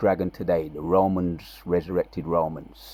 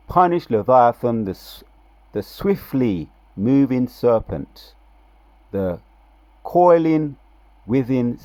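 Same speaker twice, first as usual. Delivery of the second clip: louder, higher preaching of scripture, dragging the key words; 4 semitones higher, 6 dB louder, slower than usual. Acted. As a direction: proclaim the prophecy like a preacher.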